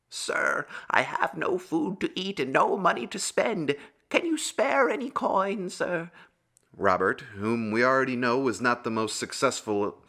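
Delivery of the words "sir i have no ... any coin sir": high-pitched beggar asking for coins; pleading beggar. A narrator's reading. Beggar asks the farmer to spare a coin, high pleading voice. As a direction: plead in a much higher beggar's voice.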